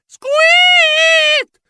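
very high, loud, drawn-out squeal; mouse shriek. A narrator's reading. Mouse hero hauled up by a hook starts shrieking, loud squeal. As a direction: shriek like a cartoon mouse being grabbed. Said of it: "mi misi a strillare".

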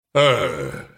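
slow giant voice, drawn-out words; sighing ghost. A narrator's reading. Ghost explaining the costly treatment, sighing and slow. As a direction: answer with a heavy sigh. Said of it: "con un sospiro".

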